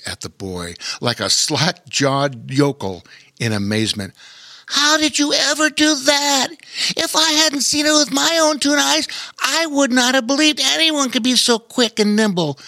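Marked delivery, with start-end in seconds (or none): 4.7-12.6 high, far-off critter voice full of wonder; amazed otter. A narrator's reading. Otter marvelling at the boy's speed, high amazed voice. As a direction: play the otter, dazzled by what he saw. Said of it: "said the otter in amazed wonder".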